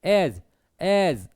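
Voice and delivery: एज stretched out slowly; stressed, lengthened. Shown as a demonstration. Teacher showing a stressed syllable, एज slowly drawn out. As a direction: hold the vowel of एज long. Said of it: "थोड़ा सा लय लंबी हो रही है".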